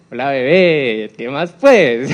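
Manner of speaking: slow, drawn-out Paisa Colombian accent demo; Paisa greeting. Shown as a demonstration. Lecturer demos a Paisa greeting for the class, in a Paisa accent. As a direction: greet like a Medellín local, singsong Paisa. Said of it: "una palabra chévere en paisa".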